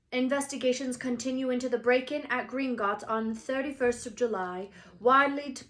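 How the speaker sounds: newspaper read. Acted. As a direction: read it like a news report. Reading news cutting.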